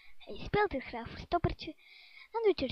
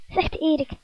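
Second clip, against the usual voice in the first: plain reading voice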